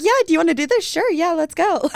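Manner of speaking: eager yes. Retold or imitated. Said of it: "You're like".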